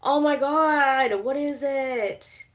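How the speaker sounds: high, drawn-out exasperation; exasperated. Real, not acted. Streamer can't think of the Erica line, exasperated. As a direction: stretch it out, straining to remember. Cannot recall her favourite line.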